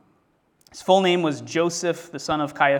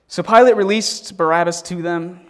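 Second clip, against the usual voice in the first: ordinary delivery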